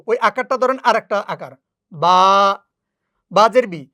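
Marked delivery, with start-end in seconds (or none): 1.9-2.2 বা with its long aa held; lengthened vowel. Shown as a demonstration. Teacher showing how khara zabar is read, lengthened বা. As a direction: draw out the vowel in বা. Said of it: "একটু টেনে শুধু".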